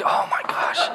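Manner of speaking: breathy laughing whisper of disbelief; whispered oh my gosh, laughing. Retold or imitated. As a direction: mutter it under your breath, amused. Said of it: "You're like".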